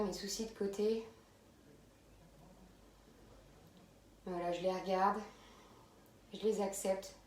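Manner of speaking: calm, unhurried meditation voice with long pauses; guided meditation. Real, not acted. Yoga teacher easing students into abdominal breathing, calm guided meditation. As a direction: settle them gently into the breath, leaving space between phrases.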